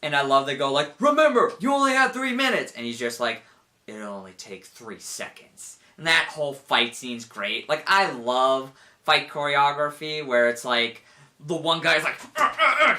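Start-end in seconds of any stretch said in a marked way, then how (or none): 1.0-2.7 high, raised-voice reminder; called-out warning. Retold or imitated. Comrades calling out a warning. 3.9-5.8 low, very soft and slow; cool understated reply. Retold or imitated. Cool hero's unbothered reply. 12.4-12.9 high, loud effort grunts; imitated straining grunts. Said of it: "trying as hard as they can".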